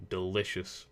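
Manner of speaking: ordinary talk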